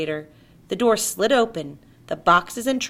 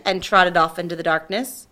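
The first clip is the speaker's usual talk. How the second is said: plain reading voice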